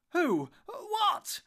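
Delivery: high, loud startled questions; alarmed shout. A narrator's reading. Hobbit rushing round the tree, shouting in alarm. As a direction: shout each word urgently. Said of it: "shouted Frodo".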